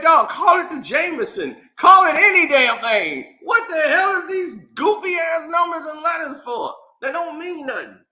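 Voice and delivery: high, loud exasperated rant; fed-up shouting at nonsense; mad as hell. Retold or imitated. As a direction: rant in comic outrage, pitch way up. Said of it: "Man, I'm mad as hell".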